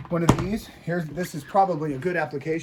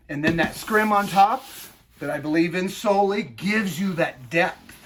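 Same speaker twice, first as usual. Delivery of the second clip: ordinary talk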